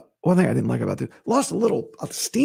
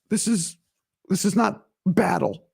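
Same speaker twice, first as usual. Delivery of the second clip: usual speaking voice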